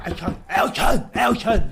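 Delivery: loud shouted name; shouting Elton through the window; calling out. Retold or imitated. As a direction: bang on the glass and yell. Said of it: "banging on the window".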